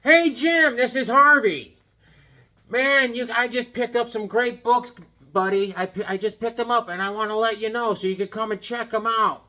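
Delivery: high-pitched, hearty caller voice; loud high hail easing into a high, steady patter; Harvey. Retold or imitated. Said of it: "I'll do my Harvey impersonation".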